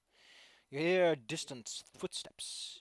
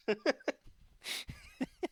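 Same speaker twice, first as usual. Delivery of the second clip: very high giggle; high squealing laugh. Acted.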